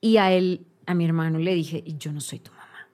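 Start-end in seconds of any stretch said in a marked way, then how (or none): 1.8-2.9 very soft, lower, nearly whispered; hushed retold statement. Sister telling her brother she's not his mom, hushed. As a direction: say it under your breath, low. First, gentle retelling to brother.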